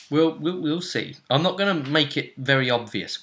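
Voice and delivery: ordinary talk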